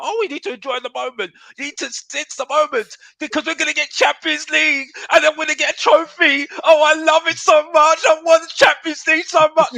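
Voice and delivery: high, loud mocking whine of a gushing fan. Mocking Spurs fans who gush about Champions League. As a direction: mimic the fans as overexcited and silly. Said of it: "this crap about".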